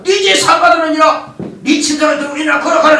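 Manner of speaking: high, loud command ringing out; forceful proclamation. Retold or imitated. Jesus telling the paralytic to walk, forceful proclamation. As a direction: proclaim it at full voice, driving onward. 예수님의 선언을 힘주어 재연.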